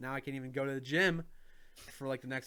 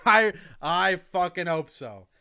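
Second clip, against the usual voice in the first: loud laugh while talking; laughing out the words; 4 semitones higher, 12 dB louder, slower than usual. Real, not acted. Laughing reply to friend.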